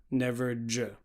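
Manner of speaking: normal talking voice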